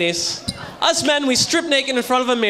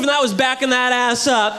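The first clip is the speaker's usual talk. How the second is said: usual speaking voice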